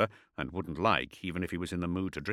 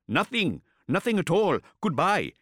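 higher, hurried denial; panicked denial; 12 semitones higher, 6 dB louder than usual. A narrator's reading. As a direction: blurt it out and flee. Ishak denies in panic.

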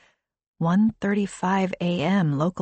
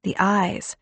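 ordinary delivery, nothing marked

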